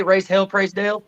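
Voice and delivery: imitating a country drawl; rural Florida drawl. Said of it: "what's the word that you guys say".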